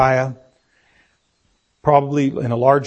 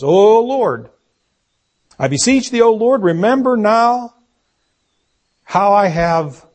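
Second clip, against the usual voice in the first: raised, fervent plea; pleading prayer; 5 semitones higher, 3 dB louder than usual. Acted. Preacher voices a desperate king praying to the Lord, pleading. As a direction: implore with drawn-out words.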